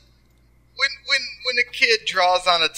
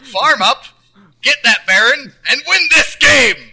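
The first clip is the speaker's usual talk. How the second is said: rallying shout; 8 semitones higher, 8 dB louder than usual. Pretend. Pep talk continues.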